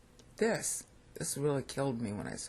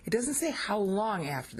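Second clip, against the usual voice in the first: raised pitch, "how long" pushed out; emphatic stress; 6 semitones higher than usual. Real, not acted. Teacher flagging an important point to students, emphatic. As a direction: drive home "how long" in a higher voice. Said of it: "This is really important".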